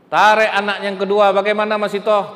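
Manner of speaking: raging king commanding Haman; furious Pharaoh. Acted. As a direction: bellow the orders to boil them. Pharaoh shouting the next order.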